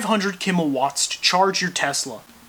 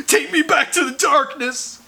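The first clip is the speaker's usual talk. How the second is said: fetus voice; 8 semitones higher than usual. Acted. Fetus wants back in womb.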